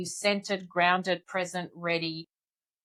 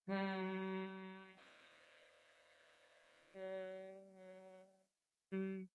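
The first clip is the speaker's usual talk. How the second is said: soft closed-mouth hum, like agreeing; gentle agreeing hum; 21 dB quieter than usual. Shown as a demonstration. Coach shows listeners a gentle hum. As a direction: hum softly as if nodding yes. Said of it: "to gently hum".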